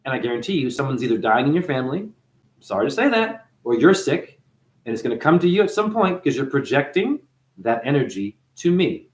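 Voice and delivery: usual speaking voice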